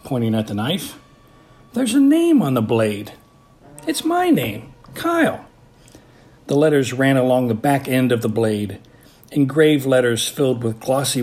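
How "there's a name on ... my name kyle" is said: put-on much higher, amazed kid voice; boy's surprise. A narrator's reading. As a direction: read it off, amazed, in a high boyish voice. Kyle voiced by narrator.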